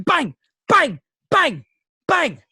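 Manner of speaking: high, loud shouted bangs; imitated gunshots. Drunk mates shooting at each other in the woods, imitated gunfire. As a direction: fire off each bang like a shot.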